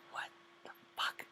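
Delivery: breathless whispered what the fuck; whispered disbelief. Retold or imitated. Reaction to the girl chiming in.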